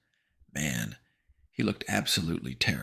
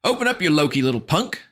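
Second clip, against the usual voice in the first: put-on higher, louder angry boy; scornful shout; angry snap; 12 semitones higher, 8 dB louder than usual. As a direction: tell him off. Jackson punching door.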